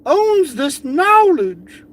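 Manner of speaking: high, slow, put-on woman's voice; mocking Amy voice. Quoting her letter back in a high slow mock voice.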